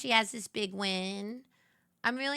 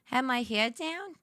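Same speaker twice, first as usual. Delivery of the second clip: ordinary talk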